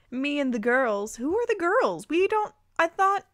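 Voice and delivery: can't believe what she's reading; baffled. Real, not acted.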